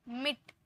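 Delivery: मिट with an instant short vowel; मिट without lengthening the i. Shown as a demonstration. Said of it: "तुरंत में sound आता है".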